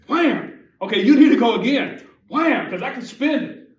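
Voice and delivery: loud, high, gleeful wham; excited. Retold or imitated. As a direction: brag gleefully, slamming each wham. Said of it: "I'm like".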